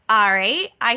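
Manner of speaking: usual speaking voice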